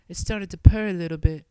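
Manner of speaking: usual speaking voice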